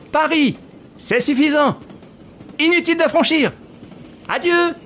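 high-pitched voice carrying to a departing train; calling farewells. Acted. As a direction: call out cheerily across a distance. Lupin calls goodbyes to departing train.

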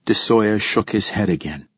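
usual speaking voice

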